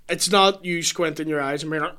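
normal talking voice

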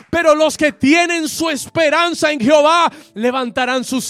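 loud, driving sermon delivery; full-force preaching. Acted. Preacher rallies the congregation, loud and fervent. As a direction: preach it with fire to the church.

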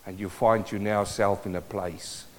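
very soft, low, solemn preaching; subdued, tender sermon voice with long pauses; hushed pastoral appeal. Real, not acted. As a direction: speak gently and low to hurting people.

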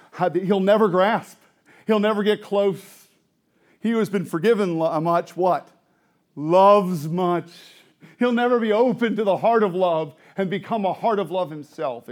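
loud, high, punched sermon phrases; emphatic preaching. Real, not acted. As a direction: hammer each phrase home to the congregation. Preacher drives point home.

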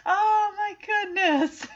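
high, slow exclamation; emotional gush. Real, not acted. Viewer overcome by the reunion, high slow gush.

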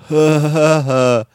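mock dumb muscleman with a loud fake laugh; Baron Corbin. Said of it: "it's just muscle up there".

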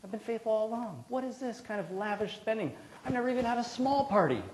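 put-on high, aggrieved complaint of a jealous older brother; resentful older brother. Preacher voicing the resentful older brother, high and aggrieved. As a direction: whine it like the son who never left.

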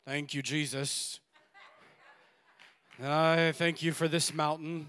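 low, slow, drawn-out praise without feeling; unfelt, reluctant thanks. Acted. Preacher mimics praying without feeling like it, reluctant and slow. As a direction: drag it out as if you don't feel like it. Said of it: "It may sound like".

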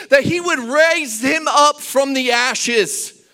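high, loud, driving sermon delivery; high-pitched shouted preaching; full-force preaching. Real, not acted. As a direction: preach it to the back row, stretching the big phrases.

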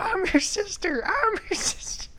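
squeaky falsetto sister; high sister voice. Retold or imitated. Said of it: "it's ten thirty".

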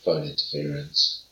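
low, soft announcer voice; low deadpan segment announcer; Simon voice. Pretend. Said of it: "it's time for".

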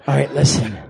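low, slow brooding-hero voice; put-on low vigilante voice; Dark Knight. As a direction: give orders in a deep hero voice. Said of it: "sound like fucking the Dark Knight".

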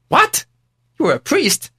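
high, sharp "what?" then questioning; shocked disbelief. Retold or imitated. Storyteller stunned by the rich man, high pitched. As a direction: gasp it out in shock.

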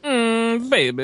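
sped-up squeaky chipmunk voice. Pretend. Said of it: "sounds like a chipmunk".

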